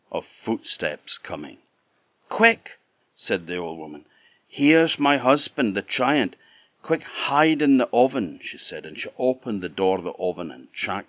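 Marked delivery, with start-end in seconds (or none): 2.3-8.4 high, loud urgent alarm; urgent warning. A narrator's reading. The wife hurries Jack into the oven, high alarmed call. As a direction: hiss it fast, the giant is coming.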